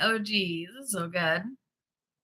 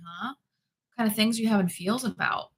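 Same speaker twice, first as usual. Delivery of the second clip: usual speaking voice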